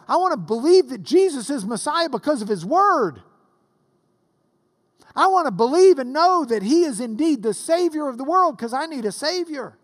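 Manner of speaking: high, loud, driving sermon voice; impassioned preaching. Real, not acted. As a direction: preach it out with full force, stretching key words. Preaches at full force.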